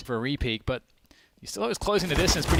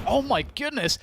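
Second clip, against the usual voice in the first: surprised; 9 semitones higher than usual. Real, not acted. Caster reacting to a play.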